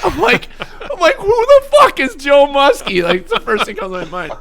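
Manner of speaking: high, loud laughing through the words; can't get it out for laughing. Real, not acted. Telling the Joe Muskie story to his friend, laughing hard.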